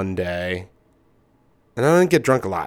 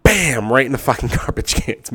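usual speaking voice

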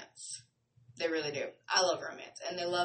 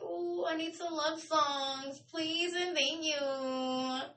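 mock pleading; 8 semitones higher, much slower than usual.

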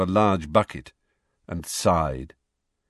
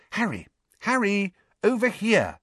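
high calls across a noisy pub; calling out; 12 semitones higher than usual. A narrator's reading. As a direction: wave someone over from across the room.

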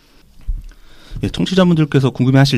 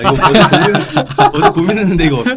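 playful high voice; 8 semitones higher, 5 dB louder than usual. Acted. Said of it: "아, 이거 안 돼".

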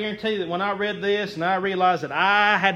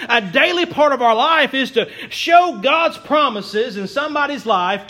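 rising, louder preacher's call; fervent preaching; 3 semitones higher, 5 dB louder than usual. Acted. Pastor urging his church to show encouragement, fervent preaching. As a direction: preach it out to the congregation. Preacher building to full force.

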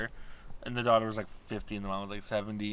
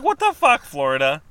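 incredulous outburst; 2 semitones higher, 10 dB louder than usual. Retold or imitated. Woman retelling her reaction to a daycare shooting, loud and appalled. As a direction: shout it at the whole state. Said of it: "I'm like".

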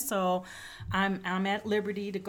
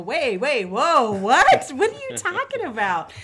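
high, much louder startled cry breaking into a laugh; reliving the moment she heard the news, loud and high; stunned disbelief, half laughing; retold shock; 5 semitones higher, 12 dB louder than usual. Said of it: "And I go".

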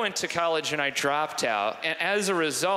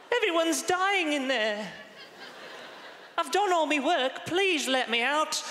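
put-on high-pitched Dickensian child worker; high, pitiful British waif; pleading urchin; 12 semitones higher than usual. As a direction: plead like a child trapped in the coal mines. Acting a Dickensian child from the mines.

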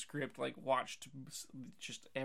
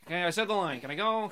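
louder, higher, eager to be done; impatient actor; 4 semitones higher, 8 dB louder, faster than usual. Retold or imitated. Mocking the actor once the line is over, impatient. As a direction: blurt it out, wanting to leave.

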